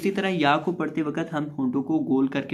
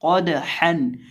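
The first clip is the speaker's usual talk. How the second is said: usual speaking voice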